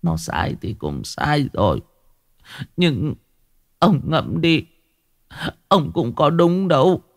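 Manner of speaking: halting tearful plea, rising in pitch; sobbing. A narrator's reading. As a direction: reason with him between sobs. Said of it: "gục mặt vào hai đầu gối mà thổn thức". (thổn thức, ngập ngừng khuyên chồng)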